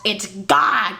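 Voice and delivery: usual speaking voice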